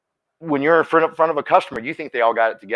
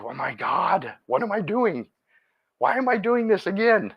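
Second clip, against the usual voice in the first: rising higher into mock despair at himself; exasperated self-talk; 8 semitones higher, slower than usual. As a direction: panic at your own choice, half joking. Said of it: "it's like".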